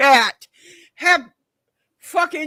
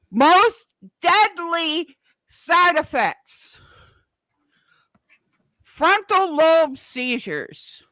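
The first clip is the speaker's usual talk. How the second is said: DEADLY SIDE EFFECTS spelled out very slowly, higher; slow, raised, emphatic "most deadly side effects"; pointed emphasis; 4 semitones higher, 3 dB louder, much slower than usual. Retold or imitated. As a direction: drive home "frontal lobe seizures" word by word. Reads out damning proof to doctor.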